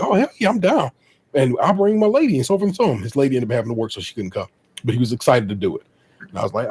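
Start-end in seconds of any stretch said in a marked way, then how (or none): 0.0-2.4 higher-pitched, eager yes; hyped. Retold or imitated. Retelling friend agreeing to the road trip, hyped. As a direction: imitate an eager friend signing on. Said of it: "but he was hyped".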